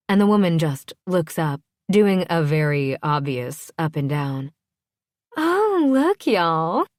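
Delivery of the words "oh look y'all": high, drawn-out Southern greeting; Southern accent. A narrator's reading. Leader of the wives greeting newcomers, Southern accent. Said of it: "with her southern accent".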